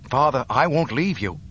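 loud, insistent protest. Acted. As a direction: push back hard. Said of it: "Luke protested".

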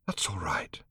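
soft whispered comfort; gentle. A narrator's reading. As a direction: soothe her as gently as you can. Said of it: "as gently as he knew how".

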